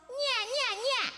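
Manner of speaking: high meowing "nyeah" repeats; cat-like sound. Shown as a demonstration. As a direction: whine like a cat. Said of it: "kinda like a cat".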